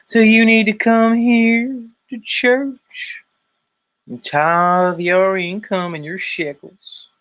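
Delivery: high, slow, stretched-out preacher proclamation; Southern preacher grift. Acted. Preacher calling folks to tithe, mock Southern sermon. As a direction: intone it like a hammed-up Southern evangelist.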